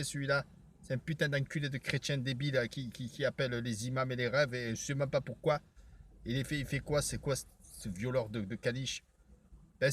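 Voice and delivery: low, hushed, menacing voice; low character voice. Acted. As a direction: keep it down low and quiet.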